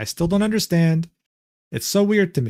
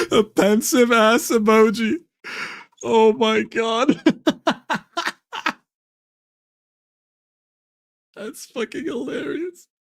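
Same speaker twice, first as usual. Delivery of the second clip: high, slow laughing voice; 7 semitones higher, slower than usual. Real, not acted. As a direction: crack up at the joke. Laughing at chat.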